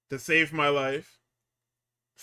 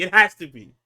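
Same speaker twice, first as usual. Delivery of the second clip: much louder, pressing the point home; emphatic insistence; 3 semitones higher, 11 dB louder than usual. Real, not acted. Host insisting to co-host, loud emphatic repeat.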